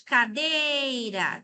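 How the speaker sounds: Cadeira slow, with "dei" punched: ca-DEI-ra; syllables of Cadeira with the strong one pushed; stress on DEI. Shown as a demonstration. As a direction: hit the second syllable of Cadeira. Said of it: "Qual é a mais forte?".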